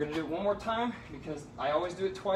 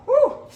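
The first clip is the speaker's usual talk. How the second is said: hot weather whoop; 13 dB louder than usual. Real, not acted. Burning hot outside.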